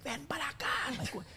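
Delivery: high, thin imitation of an angry mother; mad mom. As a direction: squeal it like mom scolding, without much volume. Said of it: "she's all mad at me".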